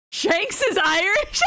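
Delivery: high, drawn-out incredulous question; slow, high-pitched amazed reaction; amused disbelief. Real, not acted. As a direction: exclaim it on the edge of real laughter. High cracking-up reaction before laughing.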